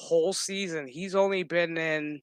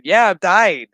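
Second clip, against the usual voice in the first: mock shout; 4 semitones higher, 12 dB louder than usual. Retelling Q admitting he is dying.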